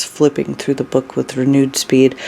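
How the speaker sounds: ordinary delivery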